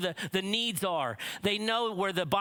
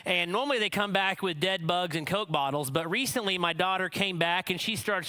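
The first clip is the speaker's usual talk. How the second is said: ordinary talk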